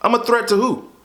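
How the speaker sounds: ordinary talk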